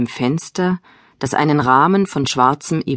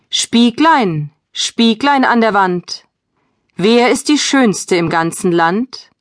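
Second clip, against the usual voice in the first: proud queen voice; 7 semitones higher, slower than usual. A narrator's reading. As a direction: call out to the mirror like a vain queen. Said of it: "ihren Spiegel fragte".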